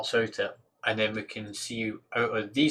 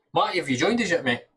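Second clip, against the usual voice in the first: raised, higher call to a friend; louder, higher hail to a viewer; 7 semitones higher, 7 dB louder than usual. Real, not acted. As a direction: call out to a mate who may be listening. Calling out to a viewer.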